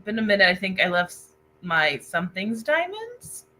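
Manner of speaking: ordinary delivery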